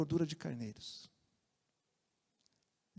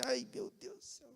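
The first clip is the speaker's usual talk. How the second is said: softer, higher, breathy sigh of defeat; exasperated sigh; 7 semitones higher, 10 dB quieter than usual. Retold or imitated. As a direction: sigh it out like you've been caught. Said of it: "Aí eu falei".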